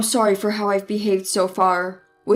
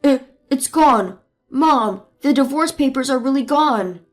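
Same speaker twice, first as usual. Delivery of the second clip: panicked husband; 4 dB louder than usual. Acted. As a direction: stammer in alarm.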